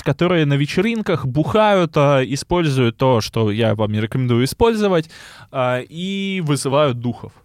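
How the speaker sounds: usual speaking voice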